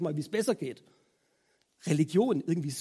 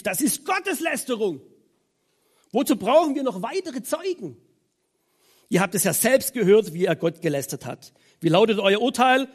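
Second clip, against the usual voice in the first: indignant outcry; 3 semitones higher, 6 dB louder than usual. A narrator's reading. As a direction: rise to an outraged verdict. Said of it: "Empört zerriss".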